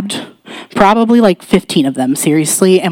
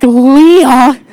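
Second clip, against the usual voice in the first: loud, high-pitched disbelief; astonished; 8 semitones higher, 5 dB louder than usual. Retold or imitated. Friend on the phone reacting to the prayer, astonished. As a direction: imitate a friend stunned by what she heard.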